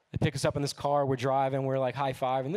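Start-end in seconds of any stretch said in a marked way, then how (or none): none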